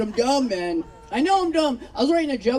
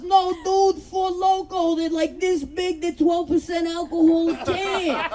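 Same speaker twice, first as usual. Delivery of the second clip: lightly voiced meathead, pitched higher; earnest gym bro; 5 semitones higher, slower than usual. Gym guy explaining Four Loko to his buddy, earnest protest. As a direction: protest in a slightly raised bro voice.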